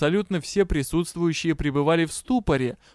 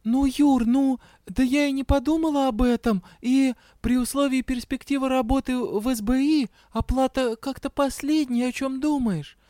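high-pitched, flustered justification; sheepish excuse; 9 semitones higher than usual. A narrator's reading. Embarrassed Дэя excuses unpaid work to Юрао, sheepish. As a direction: make a high, awkward excuse to a partner.

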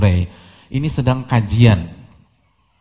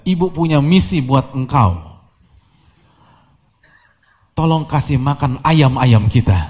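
raised, louder motherly command; mother shouting; 4 semitones higher, 5 dB louder than usual. Retold or imitated. Mother orders her son before his pupils, shouting. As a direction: call out the order before everyone. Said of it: "ibunya teriak".

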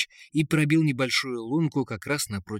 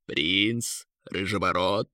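plain reading voice

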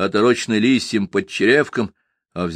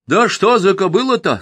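refreshed voice; 10 semitones higher, 4 dB louder than usual. A narrator's reading. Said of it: "спросил посвежевшим голосом".

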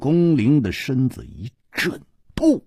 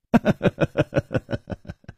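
breathy laugh; 4 semitones higher than usual. Acted. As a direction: laugh under the breath, caught. Nan Gongling caught out.

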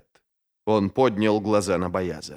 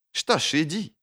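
higher offhand dismissal; tossed off; 8 semitones higher than usual. A narrator's reading. Ferro dismissing the departing man, offhand. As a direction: throw it out carelessly. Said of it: "бросила она".

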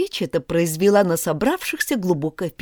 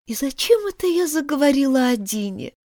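sad, musing to herself; Alice alone, sad thoughts about Dina; 6 semitones higher than usual. Acted. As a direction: wonder sadly, half to yourself. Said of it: "грустно подумала Алиса".

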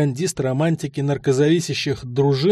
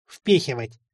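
a grumbling lower mimicry of the old man; mock the old man's word with a grumble; 3 semitones lower than usual. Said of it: "проворчал я, передразнивая деда".

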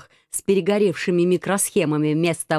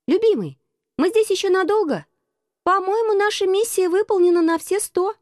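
raised, light heroine voice; high female voice; 11 semitones higher than usual. Acted. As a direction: voice her sweetly and high. Heroine speaks to Alex.

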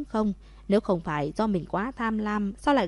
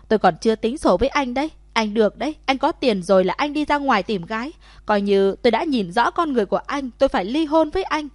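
fast, loud furious scolding, rising higher; angry shouting; 4 semitones higher, 6 dB louder than usual. A narrator's reading. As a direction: yell at the cheating husband, words tumbling out. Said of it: "tức giận mà hét lên". (hét lên giận dữ, nói nhanh)

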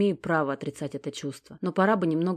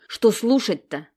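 louder, slow and annoyed; irritated question; 4 semitones higher, 6 dB louder, slower than usual. Acted. Alex, thrown off, asks irritably what to listen to. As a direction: snap back in confusion. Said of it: "раздражённо спросила".

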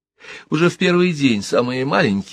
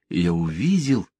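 usual speaking voice

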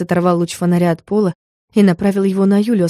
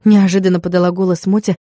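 usual speaking voice

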